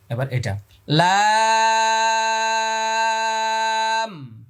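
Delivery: লাম drawn four alif long, high and held; Arabic: لام ممدود; long lam. Shown as a demonstration. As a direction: stretch the lam slowly. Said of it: "চার আলিফ টান".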